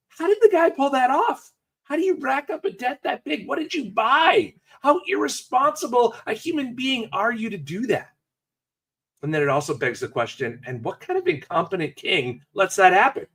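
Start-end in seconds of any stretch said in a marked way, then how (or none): 0.1-8.1 incredulous disbelief. Pretend. Listener imagining the debtor, incredulous high questions. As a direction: play a stunned listener who can't believe the debt.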